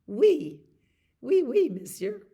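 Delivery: playful French flourish; hammed-up French accent; French oui. Shown as a demonstration.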